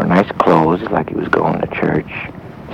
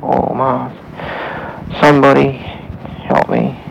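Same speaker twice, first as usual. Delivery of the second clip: crying out; 5 semitones higher, slower than usual. A narrator's reading. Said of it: "he kinda crying for".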